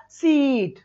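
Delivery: सीट drawn out to "seeeet"; slow demonstration of सीट's long vowel; stretched vowel. Shown as a demonstration. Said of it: "कैसे खींचना पड़ेगा?".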